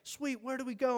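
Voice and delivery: high, loud and cheerful; eager. Acted. Congregation answering the firefighter, eager. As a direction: ask brightly, ready to go. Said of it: "And we're like".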